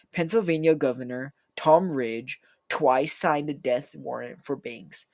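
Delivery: plain reading voice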